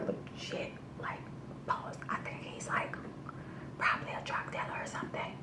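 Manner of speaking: hushed whisper, fast and secretive. Real, not acted. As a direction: lean in and whisper the suspicion. Whispered suspicion in the bar.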